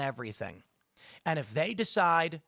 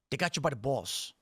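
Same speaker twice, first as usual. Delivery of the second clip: Jersey accent; 3 semitones lower than usual. Real, not acted. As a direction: let the Jersey accent slip out. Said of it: "the Jersey accent comes out".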